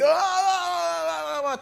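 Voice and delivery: high, drawn-out crying wail; imitated loud wail. Pastor imitates the patient's loud wailing cry. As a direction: cry out like a grieving old man. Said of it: "he began to cry quite loudly".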